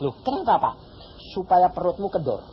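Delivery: usual speaking voice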